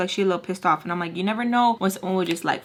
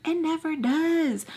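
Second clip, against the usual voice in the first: high, slow sing-song on "It never does"; mock lilting echo; 8 semitones higher, slower than usual. Shown as a demonstration. Repeats the line in a put-on voice.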